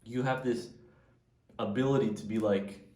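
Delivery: ordinary talk, nothing marked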